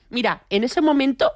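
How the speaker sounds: ordinary talk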